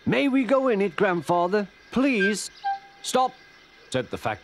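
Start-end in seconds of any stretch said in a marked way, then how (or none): 0.1-2.5 put-on high, eager child's plea; pleading child. Children asking grandfather for a ride, pleading. 3.0-3.3 short, high-pitched call. Acted. Fat controller to the guard, calling out.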